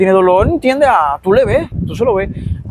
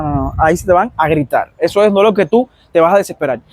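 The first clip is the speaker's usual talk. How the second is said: normal talking voice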